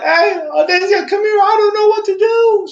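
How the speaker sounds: high, loud panicked call across the house; retold yell. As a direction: shout for help as if you're out of your depth. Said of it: "I was like yelling".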